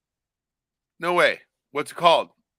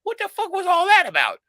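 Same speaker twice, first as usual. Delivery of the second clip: high, louder baffled bystander voice; confused bystander; 6 dB louder than usual. Retold or imitated. Bystanders on the street, baffled after the fake fight. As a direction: play the stunned onlooker. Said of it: "sitting there going".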